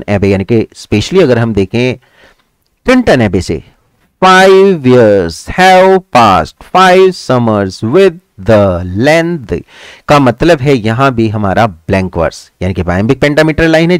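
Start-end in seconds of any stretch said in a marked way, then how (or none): 4.2-9.6 slow verse recitation. Shown as a demonstration. Teacher reciting Wordsworth's Tintern Abbey example, very slow and drawn out. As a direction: draw out every word of the verse.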